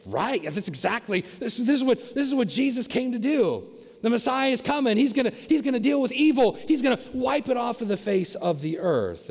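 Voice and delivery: rally like a hopeful follower; eager expectation. Retold or imitated. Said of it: "you think".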